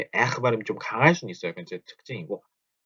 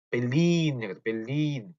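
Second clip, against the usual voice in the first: "벨린" with the final 린 stretched; stress on second syllable; slower than usual. Shown as a demonstration. Teacher repeats for learners, stressing the back of "벨린". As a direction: land on the long last syllable of "벨린". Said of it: "뒤에 강세가 붙어요".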